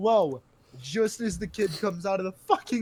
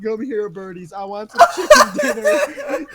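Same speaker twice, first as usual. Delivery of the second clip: mock threatening kid; 3 semitones higher, 9 dB louder than usual.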